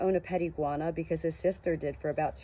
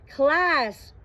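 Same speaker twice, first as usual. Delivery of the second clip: class! called out high and loud; urgent loud shout; 9 semitones higher, 8 dB louder than usual. A narrator's reading. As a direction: yell it while rushing off. Joshua shouts while ducking into car.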